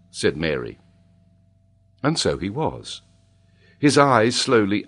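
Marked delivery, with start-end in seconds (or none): none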